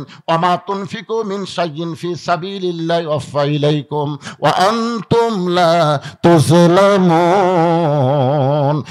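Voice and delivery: slow melodic chant, swelling at the end; Quran recitation. Real, not acted. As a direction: recite slowly, stretching the final syllables. (ধীর সুরেলা তিলাওয়াত)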